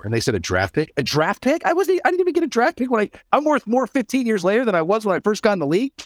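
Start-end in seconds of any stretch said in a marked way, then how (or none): 1.0-1.7 rising, disbelieving question; retold disbelief, pitch climbing; incredulous echo. 1.7-5.9 much higher, amazed rant; hammed-up incredulous retelling; retold disbelief. Retold disbelief at own trade.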